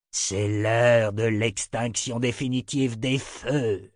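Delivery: slow, drawn-out villain's pronouncement; deliberate tyrant voice; menacing emperor. Acted. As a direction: announce doom with relish, word by word. Lança Jagang.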